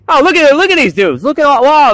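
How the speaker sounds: high, loud delighted gush; thrilled at the new toys; excited. Real, not acted. Unboxing figures, thrilled.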